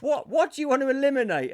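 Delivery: loud, high mock host question; quiz show host. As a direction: put it to the contestants like a hammy host. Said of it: "So then he'll go".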